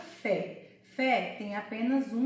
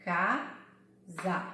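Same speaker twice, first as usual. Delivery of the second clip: "casa" split into two beats, ca, then za; syllable by syllable; 4 semitones lower than usual. Shown as a demonstration. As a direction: say "casa" slowly with a pause between ca and za. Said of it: "Você precisa falar pausadamente".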